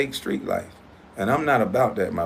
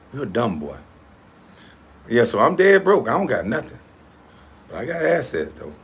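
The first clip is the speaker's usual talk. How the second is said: ordinary delivery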